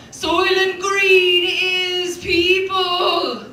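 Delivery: slow, loud, high movie cry; dramatic Charlton Heston. Retold or imitated. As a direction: drag out the line like a film climax. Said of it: "It's just Charlton Heston just".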